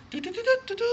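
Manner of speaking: very high tooted fanfare; cartoon trumpet fanfare. Preacher imitating trumpets for cartoon kings, high tooting. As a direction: toot like a royal herald's trumpet. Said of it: "Cómo se paran los".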